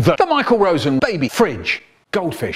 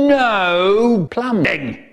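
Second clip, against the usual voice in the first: loud, long-drawn strict teacher's order; 5 semitones higher, 3 dB louder, much slower than usual. Acted. Teacher out front of the class, strict and drawn out. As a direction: stretch it out high like a teacher laying down rules. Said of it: "She used to stand out the front going".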